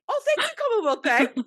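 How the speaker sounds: very high, loud mock-gracious thanks; mocking the scammer with fake gratitude; sarcastic thanks.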